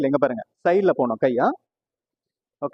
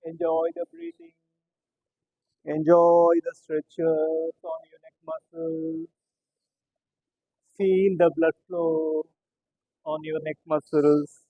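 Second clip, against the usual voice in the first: slow, soothing yoga guidance; guided breathing voice; 3 dB quieter, much slower than usual. Acted. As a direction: draw each word out gently.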